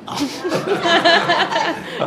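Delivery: laughing through the words. Real, not acted. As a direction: giggle while saying it. Laughing about grandma's joke.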